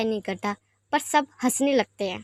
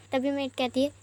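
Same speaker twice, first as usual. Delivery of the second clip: plain reading voice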